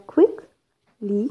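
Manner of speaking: slow "quickly", broken after QUICK; stress on first syllable. Shown as a demonstration. Teacher repeating an adverb example, syllable-split stress on "quickly". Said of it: "stress on the first syllable".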